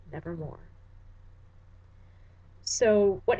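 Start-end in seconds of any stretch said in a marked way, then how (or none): 0.1-0.6 a soft, final nevermore; hushed raven reply. Acted. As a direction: drop to a low, quiet nevermore. Final word of the poem.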